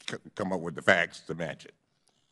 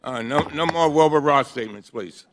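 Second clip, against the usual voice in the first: laughing interruption; 7 semitones higher, 7 dB louder than usual. Real, not acted. Chairman cuts in laughing.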